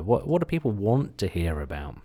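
normal talking voice

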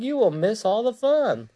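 high-pitched pleading; crying out. A narrator's reading. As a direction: coax a friend in a high storybook voice. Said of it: "cried Frog".

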